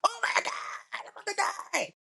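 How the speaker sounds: high falsetto wail; mock-cry in squealing terror; scared to death. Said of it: "I was scared as a motherfucker".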